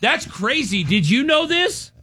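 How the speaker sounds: high, slow imitation, pitch soaring on the question; mock weird-talking girlfriend. Girlfriend pointing at her phone, mock-amazed. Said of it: "talks to me like that".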